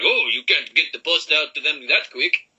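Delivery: bad Italian chef accent; hammed-up Italian head chef voice. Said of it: "That's the worst Italian accent I've ever heard".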